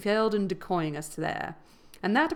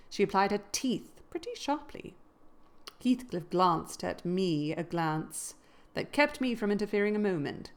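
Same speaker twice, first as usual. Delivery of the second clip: ordinary delivery, nothing marked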